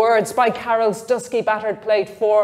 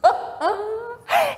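put-on high, breathless girlish titter; 12 semitones higher than usual. A narrator's reading. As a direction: burst into a giggling titter. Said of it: "she laughed".

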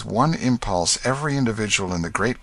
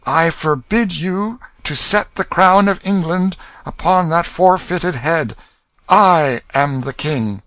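high, loud, slow royal command; solemn warning; 9 semitones higher, 6 dB louder than usual. A narrator's reading. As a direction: forbid it with full authority. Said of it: "delivered this note of warning".

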